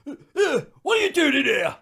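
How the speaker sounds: high, loud startled challenge; hammed-up crewman catching intruders; boat crewman impression. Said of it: "guys working on the boat".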